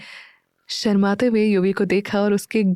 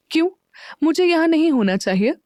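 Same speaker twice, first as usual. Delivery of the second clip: higher, sulky retort; pouting; 7 semitones higher than usual. A narrator's reading. Meera sulkily challenges Neel, higher pouting voice. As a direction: pout and throw it back. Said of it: "Meera मुंह बनाते हुए बोली".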